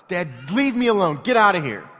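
put-on high, loud outburst of an upset child; tearful yelling. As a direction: imitate a boy yelling dad away. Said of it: "tears filled with eyes".

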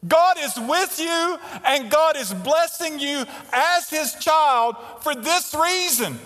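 full-force preaching. Acted. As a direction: press the point on the church. Preaching through Psalm 23.

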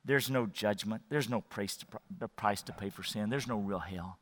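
very low, hushed and coaxing; quiet tempter in your ear; tempting inner voice. Acted. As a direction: play it down in a soft murmur. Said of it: "what it's gonna sound like in your ears".

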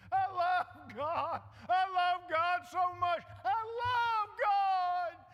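high, slow, syrupy mock devotion; gushing churchgoer. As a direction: ham up a gushing believer. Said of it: "Oh, I love, Pastor, let me tell you".

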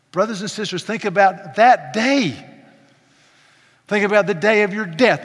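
loud, fervent preaching climax; preaching at full force. Acted. Sermon rising to climax.

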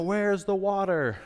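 high, drawn-out mock complaint; mock grumbling. Preacher imagining the Israelites in the wilderness, mock grumbling. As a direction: whine it slowly, pitched well up. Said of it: "despite all of their grumbling".

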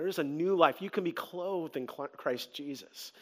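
low, soft, intimate plea; tender appeal. Acted. Preacher urging the congregation to help others, soft and low. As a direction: bring it down and speak close and gentle.

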